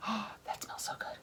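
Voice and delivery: breathy whisper of delight. Real, not acted. Vlogger smells the scrub, whispering in delight. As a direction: whisper it like a treat.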